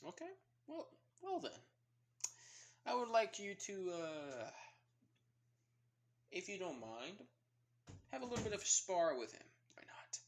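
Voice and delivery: high, airy voice for the president; a high-pitched, lightly voiced president. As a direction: lift it up high and light, like an amused elder. The president voiced, proposing a spar.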